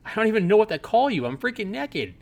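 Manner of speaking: high, fast, panicked rant; total freak-out. Pretend. Naked woman freaking out at the android in her shower. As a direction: lose it completely at the intruder. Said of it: "freak out completely and say".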